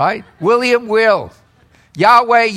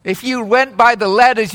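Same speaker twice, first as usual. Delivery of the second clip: ordinary delivery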